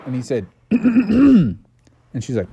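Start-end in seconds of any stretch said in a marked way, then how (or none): 0.7-1.5 high, hinting ahem; pointed throat-clearing. Acted. Bubby waits for his tip, pointed throat-clearing. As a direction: clear your throat to drop a hint.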